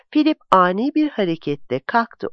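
usual speaking voice

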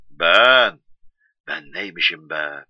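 a loud, drawn-out "me", savoring it; self-important. Acted. Man crowning himself the sixty-third wise one, self-important. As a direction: announce yourself with swelling pride. Proudly answers his own question.

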